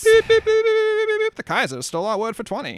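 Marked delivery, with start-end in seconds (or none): 0.0-1.3 high, loud beeping alert sounds; news jingle beeps. Acted. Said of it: "second piece of news".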